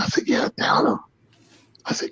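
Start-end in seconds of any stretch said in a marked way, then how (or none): 0.3-1.0 retold whisper.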